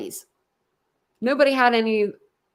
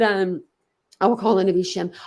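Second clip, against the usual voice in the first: ordinary talk, nothing marked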